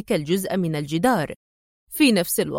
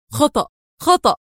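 raised, emphatic cry of reproach; exclaiming; 5 semitones higher, 4 dB louder, faster than usual. A narrator's reading. Man rebuking the woman for her visit, exclaiming. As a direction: exclaim it high, word by word. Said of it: "وهو يهتف".

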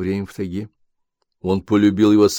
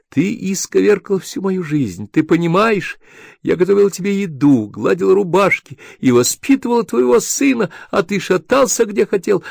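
aggrieved wife; 10 semitones higher, 4 dB louder than usual. Acted. Wife wailing at husband about her life, high.

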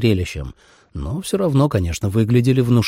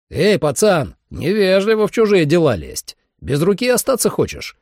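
higher-pitched, lightly raised warning call; mild shout; 8 semitones higher than usual. A narrator's reading. Sam warns a nosy boy off the horse trailer, mild shout. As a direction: call out a threat to a nosy kid. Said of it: "крикнул Сэм".

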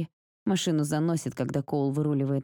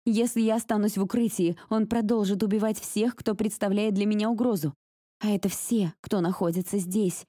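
urgent; 5 semitones higher than usual. Acted. Panicked heroine narrating and pleading.

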